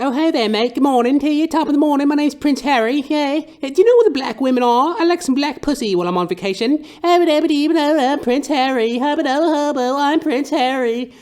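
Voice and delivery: very high, loud, silly posh British voice; goofy high-pitched mock British royal; Prince Harry impression. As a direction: ham up a squeaky toff prince. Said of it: "He's like".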